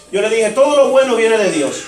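ordinary delivery

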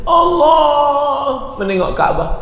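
high, drawn-out imitation wail; hammed-up slow tearful cry; mock weeping. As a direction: wail like an overcome pilgrim. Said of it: "dan lain menangis".

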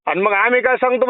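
ordinary talk

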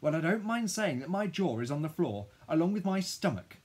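high, loud indignant rant; outraged disbelief. A narrator's reading. Child stunned by new school news, high outraged rant. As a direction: build an incredulous protest, pitched high.

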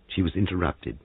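ordinary delivery

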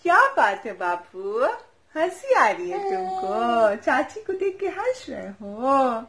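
slow, high sing-song cooing. Real, not acted. Aunt cooing to the laughing baby, slow high sing-song.

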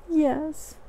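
tiny high voice; meek Piglet. Acted. Piglet confirms it was the balloon, meek and high. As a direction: admit it in a small, high squeak.

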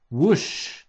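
a rushing whoosh; whoosh sound effect. A narrator's reading. Reader voices the chair shooting up, whooshing. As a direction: swoosh it like a rocket launch.

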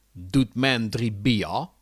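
slow Hague dialect, R turned into "ja"; Hague accent. Acted. Hagenees ordering three beers, Hague accent. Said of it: "Een Hagenees".

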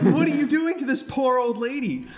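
high-pitched incredulous protest; appalled disbelief. Retold or imitated. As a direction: plead in acted horror. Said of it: "I'm like".

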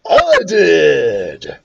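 put-on drawn-out boastful boy; bragging. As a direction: own up to it proudly. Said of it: "Ryan bragged".

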